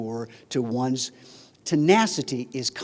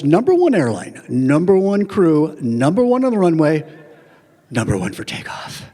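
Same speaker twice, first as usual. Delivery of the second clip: proud airline captain; 7 dB louder than usual. Retold or imitated. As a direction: announce yourself like the proudest captain alive. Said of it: "very proud airline captain voice".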